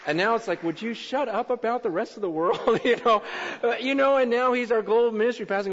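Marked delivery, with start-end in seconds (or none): 0.6-2.8 mock exasperation. Mock annoyed pastor complaining.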